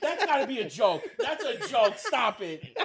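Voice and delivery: laughing while protesting; half laughing disbelief. Real, not acted. Reacting to scream clip.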